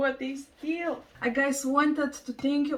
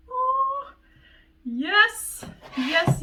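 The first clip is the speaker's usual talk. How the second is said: excited squeal; 9 semitones higher, 5 dB louder, much slower than usual. Real, not acted. Excited over repotted plant.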